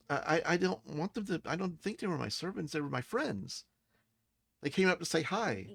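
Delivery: put-on high-pitched child, hesitant at first; child Zale defending herself. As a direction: protest innocently in a child's high voice. Game master voices child Zale.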